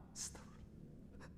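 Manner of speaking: slow, voiceless prayerful whisper; murmured istighfar. Acted. Mourner seeing the parent's photo, whispered plea for forgiveness. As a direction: breathe it out slowly under your breath. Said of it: "sambil istighfar".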